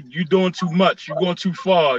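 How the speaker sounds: ordinary delivery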